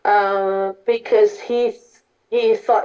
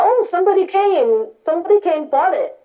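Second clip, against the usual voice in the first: woman's voice; 7 semitones higher than usual. Acted. Woman answers Devadatta.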